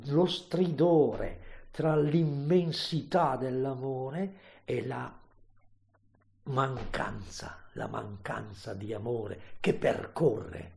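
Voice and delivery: normal talking voice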